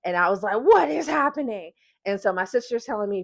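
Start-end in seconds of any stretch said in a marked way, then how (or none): 0.6-1.7 mock panicked cry, higher pitched; retold freak-out. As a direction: act out a bewildered, alarmed question. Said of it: "I'm freaking out and I'm screaming".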